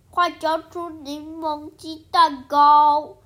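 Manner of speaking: loud, slow threatening demand; bully demand. Acted. The baboon demands the lemon cakes, loud and slow. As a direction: bark it out like a bully.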